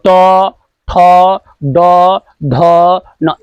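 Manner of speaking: tongue curled back, letter by letter; slow spaced recitation. Shown as a demonstration. Teacher demonstrates retroflex sounds to class, slow and spaced. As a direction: say each retroflex letter apart. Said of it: "ऐसे मुड़ के आता है".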